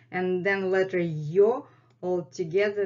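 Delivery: ordinary talk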